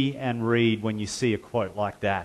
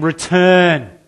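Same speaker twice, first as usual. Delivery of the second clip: a louder, higher "Return"; loud call to return; 6 semitones higher, 10 dB louder than usual. Acted. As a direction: call out "Return" to each listener. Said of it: "He's saying".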